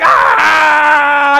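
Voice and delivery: loud, high excited shout; mock team roar; fired-up roar. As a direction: roar like a pumped-up player. Players roused by coach.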